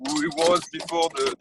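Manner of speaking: usual speaking voice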